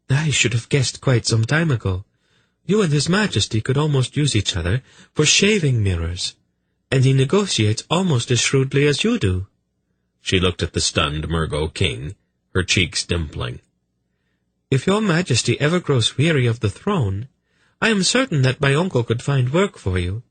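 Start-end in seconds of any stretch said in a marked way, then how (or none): 0.1-9.5 high, teasing feminine voice; sly young noblewoman, amused. Acted. Velvet explaining how she guessed the brothers' secret, amused. 14.7-20.2 high, teasing feminine voice; sly young noblewoman, amused. Acted. Velvet explaining how she guessed the brothers' secret, amused.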